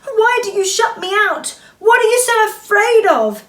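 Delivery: high, loud angry outburst; shouted angry plea; angry cry. Acted. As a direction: cry it out angrily at your sister. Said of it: "she cried angrily".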